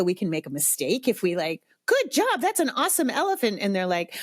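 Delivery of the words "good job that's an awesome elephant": high, quick cheerleading gush; hammed-up enthusiastic parent; gushing praise. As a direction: overdo the praise, pitched way up. Acts out overeager parent praising drawing.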